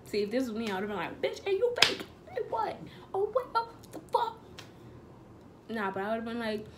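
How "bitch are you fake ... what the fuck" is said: high-pitched, incredulous outburst; mock-startled and high; shocked reaction. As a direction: act out baffled disbelief, voice shot way up. Acts out how she would react.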